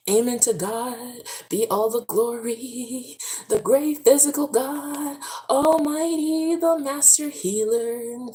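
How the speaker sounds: high, slow gospel praise; preaching praise. Real, not acted. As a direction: praise like in church. Praising God.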